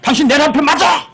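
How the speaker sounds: high, shouted marital outburst; angry wife. Acted. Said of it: "화내는 거죠". (남편에게 신경질 내며 높게 소리치는 아내)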